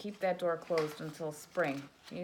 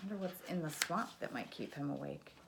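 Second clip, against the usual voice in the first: ordinary delivery, nothing marked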